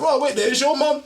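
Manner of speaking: high, excited dawning; lightly acted eureka; retold realisation. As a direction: pitch it up like it just clicked. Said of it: "I was like".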